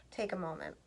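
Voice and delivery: breathy sigh, voice gone to a whisper, quick; softly sighed, barely voiced. Shown as a demonstration.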